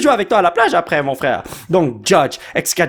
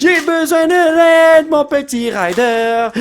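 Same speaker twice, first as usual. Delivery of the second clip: mock pleading; 10 semitones higher, 3 dB louder, much slower than usual. Real, not acted. Pleading to the card pack.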